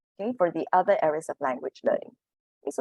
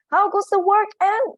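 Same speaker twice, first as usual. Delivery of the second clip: much higher and louder call; farmer calling; 8 dB louder, slower than usual. Acted. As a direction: bellow it up the stairs. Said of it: "he called".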